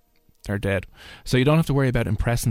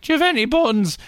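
put-on much higher old lady voice; imitating elderly lady; 13 semitones higher than usual. Old lady asking the lad for buns, high pitched. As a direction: ask it high like a fussy old woman. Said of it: "She's like".

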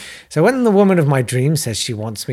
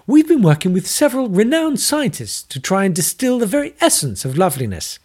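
much higher, bright eager scientist; excited; 8 semitones higher, 4 dB louder than usual. Acted. Maggie shows off her lab, high and excited. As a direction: gush about the project. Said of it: "said Maggie excitedly".

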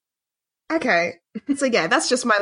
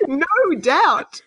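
ordinary talk

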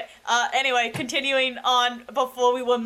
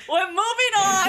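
laughing; 10 semitones higher, slower than usual. Real, not acted. Laughing through teasing.

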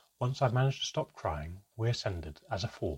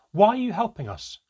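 much louder and higher shout; 11 semitones higher, 9 dB louder than usual. Acted. Child shouting while pushed out, loud. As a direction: yell it over your shoulder. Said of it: "I shouted".

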